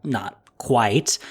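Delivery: ordinary talk, nothing marked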